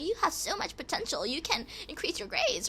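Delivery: a hint of sugary adult praise; imitating encouraging people. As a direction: lightly voice the encouragers, sweetly. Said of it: "until people realized, like".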